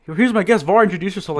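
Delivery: high, bright host announcing a guest; mock host voice. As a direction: play the eager show host. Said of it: "I'm just like".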